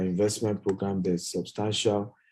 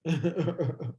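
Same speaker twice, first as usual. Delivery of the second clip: real chuckle, higher than speech; amused chuckle; 5 semitones higher, 4 dB quieter than usual. Real, not acted. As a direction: chuckle at the audience's answers. Amused by audience's identical guesses.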